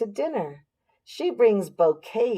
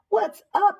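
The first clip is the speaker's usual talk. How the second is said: put-on girl asking; 4 dB louder than usual. Girl asks neighbour.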